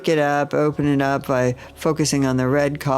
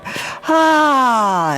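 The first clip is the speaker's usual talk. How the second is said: high, louder open ah; sung sigh; 11 semitones higher, 5 dB louder than usual. Shown as a demonstration. Teacher showing the heart chakra sigh. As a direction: release a big ringing ah. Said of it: "Ah is the exercise for it".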